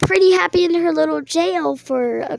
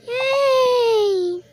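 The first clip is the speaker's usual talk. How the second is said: high excited cheer; 8 semitones higher than usual. Pretend. Kid cheers at the pretend trial, excited squeal. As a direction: squeal yay much higher.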